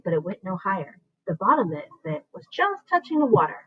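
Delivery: usual speaking voice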